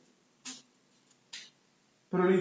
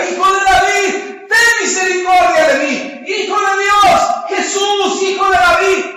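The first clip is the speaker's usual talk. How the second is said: high, very loud repeated pleading; shouting; 10 semitones higher, 11 dB louder than usual. Acted. Bartimeo cries straight to Jesús for mercy, shouting. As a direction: cry out each title at full voice. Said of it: "y le gritaba".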